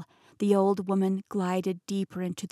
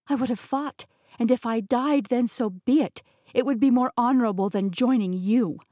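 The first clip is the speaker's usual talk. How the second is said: higher, louder defiant declaration; 4 semitones higher, 5 dB louder than usual. Acted. Heroine refusing to join Rasputin, loud defiant vow. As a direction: throw it in his face, proud.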